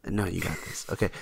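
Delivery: hushed pep talk. Pretend. Mock coaching pep talk.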